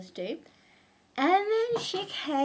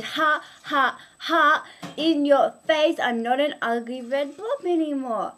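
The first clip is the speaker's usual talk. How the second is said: gloating taunt; 5 semitones higher, 7 dB louder than usual. Acted. Blob gloats to Pretty Flower.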